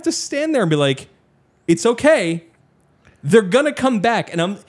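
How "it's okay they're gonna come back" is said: high-pitched attempt to comfort; strained reassurance. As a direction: reassure crying kids, pitched well up. Reassuring crying children left behind.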